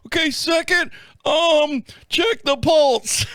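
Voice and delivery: high voice, dragging the words out; hammed-up clueless trainee; rookie 911 dispatcher. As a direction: stall slowly as you read from the chart. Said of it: "like a nine one one dispatcher on his first day".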